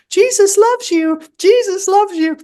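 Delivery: very high, loud squeaky toy; talking teddy bear. Acted. Author quotes the story's talking teddy, squeaky. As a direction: chirp it like a pull-string toy. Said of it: "there's a talking teddy bear that says".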